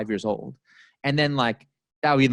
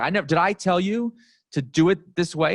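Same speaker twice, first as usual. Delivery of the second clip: higher, indignant imitation of a boss; retold mild yelling; 7 semitones higher than usual. Boss to product manager who blamed his rules, indignant. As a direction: act out a boss protesting in disbelief. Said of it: "yelling at this poor product manager".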